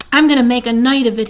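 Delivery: loud, quick burst of relish; gleeful rat. A narrator's reading. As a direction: announce it with eager relish. Rat excited about the fair.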